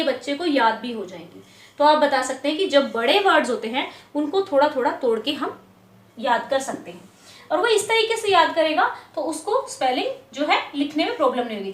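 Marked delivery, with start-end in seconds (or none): none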